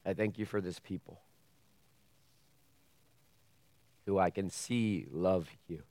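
hushed closing prayer before the sermon. Real, not acted. Opening prayer.

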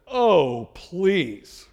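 a loud scoffing oh then a dismissive please; eye-rolling sister. Retold or imitated. Sister scoffing at her know-it-all brother, eye-rolling. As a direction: brush him off with a sigh. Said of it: "she rolled her eyes".

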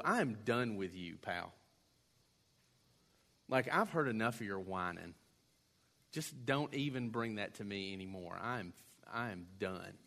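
fed up. Retold or imitated. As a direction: dismiss the whiner with weary contempt. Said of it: "look at the guy and go".

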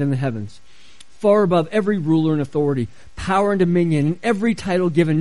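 low, measured Bible reading; scripture reading. A narrator's reading. Pastor reads Paul's prayer to the congregation, low scripture reading.

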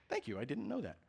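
ordinary delivery

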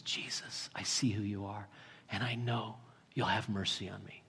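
low, near-whispered prayer; hushed, acted beggar's plea; humble appeal. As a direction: speak very softly, humbly trusting.

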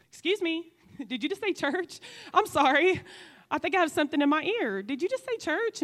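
high, loud, mock-stunned; incredulous disbelief. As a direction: pretend you can't believe your ears. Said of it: "And I'm like".

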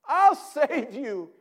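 loud, high, eager rescue call; heroic shout. Acted. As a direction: charge in yelling. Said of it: "And the activist shouts".

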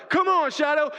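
high, loud rallying cry; forceful, insistent pleading shout; urging shout. Acted. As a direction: yell down to a friend to get up. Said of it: "when Chance is telling Shadow".

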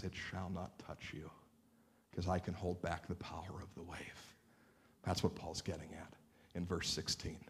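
soft, low intimate sermon voice; hushed preaching. Acted. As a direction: draw them in close, almost whispering.

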